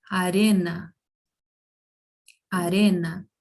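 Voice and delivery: arena with a single tap r, as in Portuguese; tapped r. Shown as a demonstration. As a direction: say arena with a quick flap on the r. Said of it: "fica com o mesmo som que em português".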